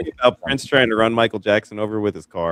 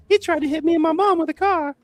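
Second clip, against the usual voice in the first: very high falsetto impression, a bit louder; 4 dB louder than usual. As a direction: put on a pop star's high voice to complain. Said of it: "he does his impression of [name]".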